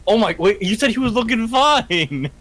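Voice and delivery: loud, high-pitched disbelief; shocked protest. Real, not acted. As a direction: cry out in surprised objection. Protests sudden death of giant.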